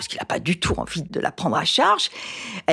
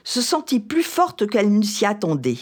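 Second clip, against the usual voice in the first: measured, expressive reading of a novel scene; expressive reading of a tense marital confrontation from Indiana; literary reading; 4 semitones higher than usual. Acted. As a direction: read it like a storyteller bringing the scene to life. Reads a novel excerpt aloud.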